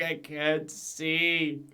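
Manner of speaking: slow, drawn-out imitation of Ray; lightly voiced Ray, slowed right down; slow impression of Ray. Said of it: "That's the kind of stuff he's saying".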